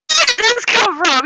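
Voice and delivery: high, loud retold disbelief; mock incredulous. Imitates game master objecting, incredulous.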